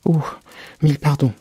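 high, loud apologetic cry; quick exclamation. A narrator's reading. Alice fears she hurt the poor animal, crying out apologetically. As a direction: burst out apologising. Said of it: "s'écria vivement Alice".

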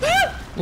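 short, very high yelp; quick high-pitched scream; startled shriek. Real, not acted. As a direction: yelp in sudden fright. Startled in game.

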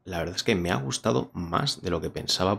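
voz de presentador, pausada; reseña a cámara. Acted. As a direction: cierra el vídeo con la opinión. Closing review to camera. (tono de reseña)

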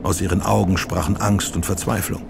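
plain reading voice

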